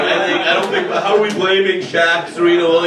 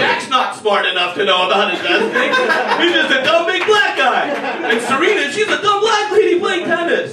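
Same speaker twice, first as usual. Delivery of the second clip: mocking voice; 8 semitones higher than usual. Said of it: "He's like".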